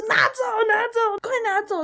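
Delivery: high, squealed mock cry for help; retold panicked shouting. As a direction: imitate crying out frantically in a nightmare. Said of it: "我就一直大喊着".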